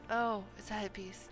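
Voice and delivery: ordinary talk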